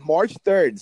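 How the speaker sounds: loud, pumped call of the date; excited announcement. Real, not acted. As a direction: sell the date like a hype man. Hyping his own event date.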